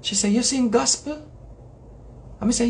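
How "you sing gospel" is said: lightly voiced old lady, higher and rising; mild old-lady impression, pitched up; elderly lady. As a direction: imitate an old woman's hopeful question. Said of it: "elderly lady".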